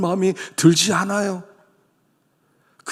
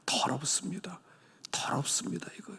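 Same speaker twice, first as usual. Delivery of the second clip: whispered confession; 12 dB quieter than usual. Retold or imitated. Confessing uncleanness.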